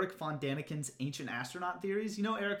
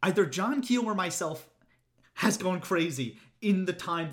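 rising rant; 2 semitones higher, 3 dB louder than usual. Real, not acted. Ranting about the author.

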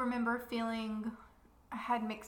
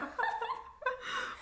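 high breathy laugh; 14 semitones higher than usual. Real, not acted. Laughing at own joke.

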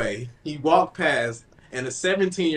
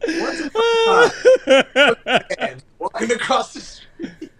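slow, laughing disbelief; 10 semitones higher, 8 dB louder, slower than usual. Real, not acted. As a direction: barely get it out laughing. Cracking up at the story.

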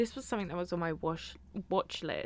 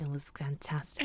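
delighted whisper; 4 semitones lower than usual. Real, not acted. Delighted aside.

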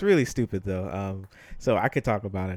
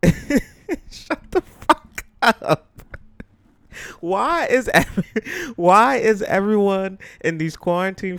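laughing, much higher and louder disbelief; incredulous outburst; 9 semitones higher, 6 dB louder, slower than usual. Real, not acted. Vlogger reacting to the analyst's freestyle with a laughing, shrieking outburst. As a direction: blurt it out in stunned, laughing disbelief.

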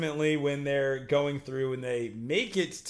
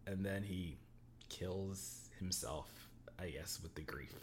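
softer and softer down to a whisper; hushed telling; 7 semitones lower, 17 dB quieter than usual. Real, not acted. Telling a friend about the brothers' deaths, fading to a whisper. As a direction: drop the voice to a whisper for the grim part.

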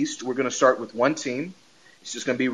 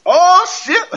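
high, loud cackling "oh shit"; laughing mock shout; 6 dB louder than usual. Stepdad spotting the kid's Capri Suns, laughing yelp. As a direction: burst out laughing as you spot the snacks.

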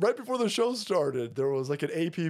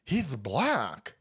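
mocking liberal ladies; 2 semitones lower than usual. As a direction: sneer it out like a clueless progressive. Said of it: "rancid public school drag weirdos said".

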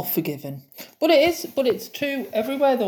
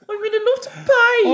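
much higher, louder sing-song Midlands plea; 11 semitones higher, 4 dB louder than usual. Retold or imitated. Patient asking Helen for the nurse in a sing-song Midlands accent. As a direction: plead in a singy Midlands lilt. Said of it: "they're so singy".

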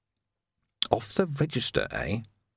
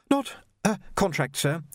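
put-on much higher, polite young Jocasta, a touch breathless; young woman voiced; 7 semitones higher than usual. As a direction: play a well-mannered girl treading water. Jocasta answers.

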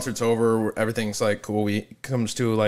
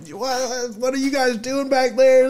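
put-on confused drunk; 11 semitones higher, slower than usual. Drunk self in retold story.

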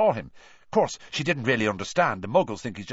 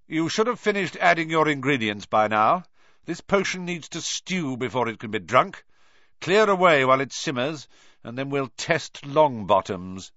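Snape; 5 semitones higher, 4 dB louder than usual. Acted. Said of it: "Snape called".

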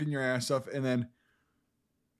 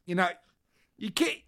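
put-on shaky grip on a Boston accent, climbing higher; failed Boston accent; 5 semitones higher than usual. Copying a friend's movie line, failing Boston accent. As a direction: try Boston and lose hold of it. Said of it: "I can't do a fucking Boston accent".

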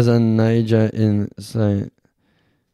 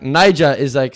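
ordinary talk, nothing marked

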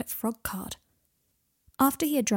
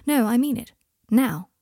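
firm, louder command; insistent; 3 semitones higher, 7 dB louder than usual. A narrator's reading. Malfoy making Harry order food, firmly insistent. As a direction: push back and allow no argument.

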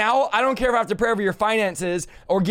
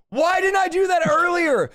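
exasperated cry; 8 semitones higher than usual. Retold or imitated. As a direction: cry it out in hindsight frustration. Retold exasperation after the success.

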